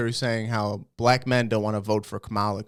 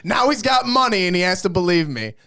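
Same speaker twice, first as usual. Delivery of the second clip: mock yell, much higher and louder; gloating dad rant; 11 semitones higher, 7 dB louder than usual. Dad gloating to his son over the phone, hammed-up yell. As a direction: ham up a triumphant told-you-so.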